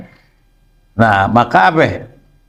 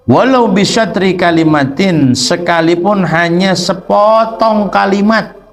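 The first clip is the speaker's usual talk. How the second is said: higher, slow phrase-by-phrase reading; kitab reading; 5 semitones higher, slower than usual. Acted. Teacher translating sacred text for students, slow measured recitation.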